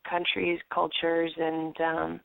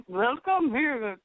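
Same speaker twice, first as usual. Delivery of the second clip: put-on slow, high Arabic accent greeting; thick Arabic accent, drawn out; Saudi accent; 5 semitones higher, 3 dB louder, much slower than usual. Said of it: "you know, Saudi accent or something".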